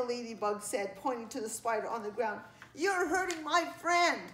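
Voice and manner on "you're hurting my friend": high, loud and deliberate; indignant accusation. A narrator's reading. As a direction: scold slowly in a friend's defence. Ladybug confronts child hurting spider.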